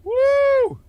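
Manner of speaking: high, loud "woo"; sudden high-pitched holler; loud whoop. Real, not acted. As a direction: let out a piercing excited whoop. Host whoops after the menacing greeting.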